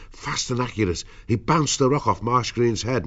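usual speaking voice

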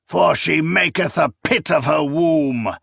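reciting scripture. A narrator's reading. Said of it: "the old priest recited".

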